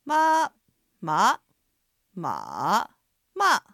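review ま through all four tones; 四声でま; four Mandarin tones on ma. Shown as a demonstration. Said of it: "今日のおさらいです".

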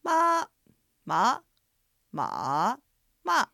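ま said in four tones, high level, rising, dipping, falling; 四声でま; four Mandarin tones on ma. Shown as a demonstration. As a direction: run ま through the four Chinese tones. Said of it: "中国語のアクセントの練習フレーズです".

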